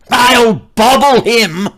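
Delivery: angry threat. Acted. Father's furious threat.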